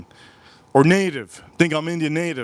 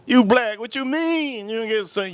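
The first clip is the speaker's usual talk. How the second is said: disbelief; 9 semitones higher, slower than usual. Retold or imitated. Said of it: "I go".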